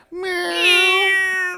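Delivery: high, drawn-out imitated meow; cat meow. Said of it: "the cat's back there going".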